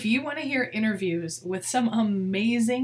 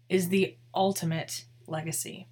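usual speaking voice

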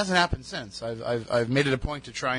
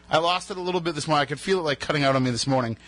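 normal talking voice

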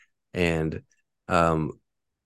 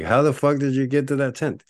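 high-pitched mock astonishment; hammed-up disbelief, voice pitched up; retold incredulity; 8 semitones higher, 4 dB louder than usual. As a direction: play a baffled questioner. Acts out astonished question.

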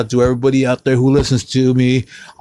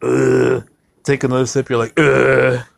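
disgusted ugh; 2 semitones higher than usual. Retold or imitated.

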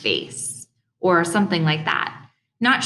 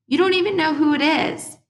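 high-pitched, exasperated retort; indignant challenge; 12 semitones higher, 3 dB louder than usual. A narrator's reading. Mother disputing her son's claim, high indignant retort.

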